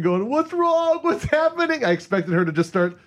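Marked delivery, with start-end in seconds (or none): 0.3-1.8 high panicked shout, half-acted; mock panicked yell. Retelling screaming at his seizing girlfriend, high panicked yell. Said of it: "I'm, like, screaming".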